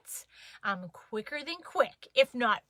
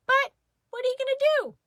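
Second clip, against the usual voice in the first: usual speaking voice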